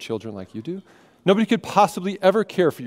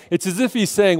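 normal talking voice

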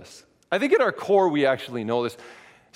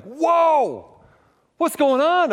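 high, loud startled cry; alarmed shout; 11 semitones higher, 5 dB louder, much slower than usual. Retold or imitated. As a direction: yelp in sudden alarm. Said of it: "I'm like".

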